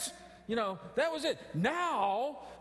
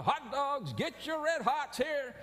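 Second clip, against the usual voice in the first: stadium vendor hawking; 8 semitones higher, slower than usual. Retold or imitated. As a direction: call it out like a hot dog vendor. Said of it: "these guys are going up and down the aisles".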